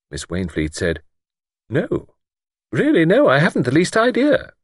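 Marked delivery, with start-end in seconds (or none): 1.7-4.5 high-pitched, protesting lady; unconvincing denial. A narrator's reading. Miss Wainfleet denying to Luke any suspicion, not quite true. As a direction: deny it a little too much, high. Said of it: "had not rung quite true".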